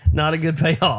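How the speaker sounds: real laughter at a weak punchline; laughing. Real, not acted. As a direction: laugh while admitting the flop. Laughing at own joke.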